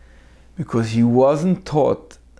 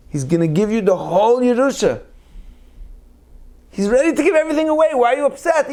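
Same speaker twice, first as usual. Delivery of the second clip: high, pressing reassurance, a touch fast; pleading mediator; 12 semitones higher, 3 dB louder than usual. Retold or imitated. Rabbi coaxing the angry brother to make peace, pressing and high. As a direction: urge the brother to calm down.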